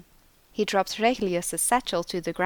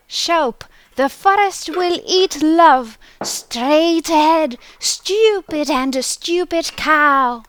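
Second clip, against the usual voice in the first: high, loud, eerie and slow-spoken; booming forest voice; 9 semitones higher, 10 dB louder, slower than usual. A narrator's reading. Hidden forest voice startling a lost clerk, high and booming. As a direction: boom out of the jungle like an unseen creature. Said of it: "The voice boomed out".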